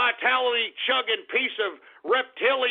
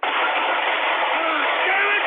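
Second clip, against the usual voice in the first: a slow, agonised wail of frustration; drawn-out anguished cry; 6 semitones higher, 4 dB louder than usual. Acted. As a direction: stretch it into a long, pained howl. Host enraged by chat shout-outs.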